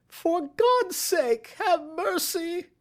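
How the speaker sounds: high, slow, desperate shriek. A narrator's reading. Thief on his knees begs the detective, shrieking. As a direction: beg for your life, voice strained high. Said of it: "he shrieked".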